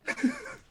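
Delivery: short high-pitched laugh; quick burst of laughter. Acted. Elaine having teased the naked boy, short high laugh. Laugh after the teasing line.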